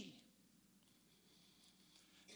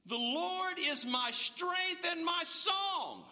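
slow, high ringing shout of praise; proclaiming shout; 8 semitones higher, much slower than usual. Acted. Preacher to the congregation, shouting the verse slowly. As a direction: call it out slowly to the rafters.

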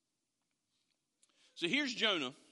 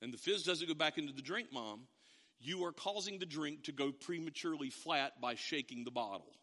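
ordinary talk, nothing marked

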